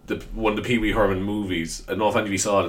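ordinary talk

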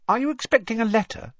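put-on high, curious girl's voice; Hermione voice. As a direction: ask it like an eager schoolgirl.

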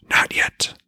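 hushed voiceless whisper of an unseen spirit; the well spirit taunting Odin in a whisper. A narrator's reading. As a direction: breathe it up from the well like a hidden spirit. Spirit refuses meeting.

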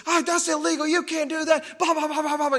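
high, indignant mock yelling that runs into blah-blah; imitated raging rant. Driver going off on a motorcyclist who cut the line, mock raging. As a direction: go off like an outraged driver, pitched well up. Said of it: "he was raging, screaming".

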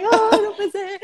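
imitating a winner who can't believe it, pitched high; a high, thrilled cry of disbelief over the prize; mock disbelieving joy. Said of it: "Y se gritan y lloran". (imitación de incredulidad feliz, voz aguda)

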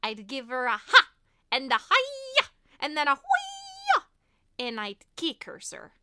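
cartoon bat's mock fight bluster; Bartok. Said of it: "the Bartok line that we said earlier".

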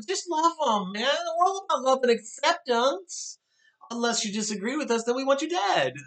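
high, sugary mock-preachy voice; high falsetto sendup of a preachy progressive; mocking sanctimonious voice. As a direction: parody a smug activist, sweet then sinister. Mocking the other side's slogans.

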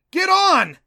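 full urgent yell; panicked shouted command. A narrator's reading. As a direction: shout it high and very loud. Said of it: "he yelled".

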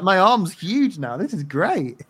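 Reed marvelling at his huge arm, high and giddy. Retold or imitated. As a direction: play Reed as a thrilled oblivious kid. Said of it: "He's just like".

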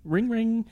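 high playful ring ring; mock phone ring. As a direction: sing out the ringing. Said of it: "role play this".

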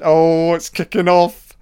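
real gamer excitement at a scrap; excited outburst. Real, not acted. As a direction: blurt it out as the brawl kicks off. Fight breaks out on screen.

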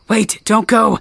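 high, loud cry to stop him; desperate plea. Acted. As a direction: shout after someone leaving for good. Calling after departing ghost.

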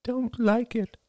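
put-on high little-boy voice, flat; boy refusing, high and flat; flat child voice. A narrator's reading. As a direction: sullen little boy, no lift. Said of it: "said Ben in a flat voice".

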